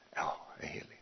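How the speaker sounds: whispered reply to God, very soft; hushed answer. Retold or imitated. Preacher answering God, whispered and very soft. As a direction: breathe the answer almost silently.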